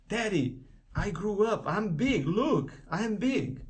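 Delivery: put-on high, insistent little-kid protest; Jojo. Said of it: "Ela assim". (imitando criança insistente, voz mais aguda)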